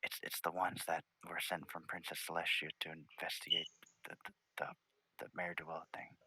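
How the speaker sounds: very soft, halting near-whisper; hushed aside. Acted. Spike leans over to explain the visitors, hushed. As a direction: confide it under your breath, stammering.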